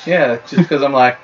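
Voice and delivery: normal talking voice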